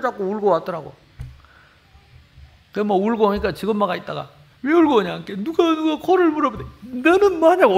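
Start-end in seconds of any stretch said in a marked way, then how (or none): 4.6-5.2 sharp, louder mock scold of a mother; a mother snapping at her crying boy; mother demanding why her son came home crying. 5.4-6.6 put-on high whiny child's voice; little son whimpering to his mother about the bite; crying little boy. 6.8-7.8 high, loud exasperated scold; shrill mother yelling at her boy; mother berating her son for not hitting back. Retold or imitated.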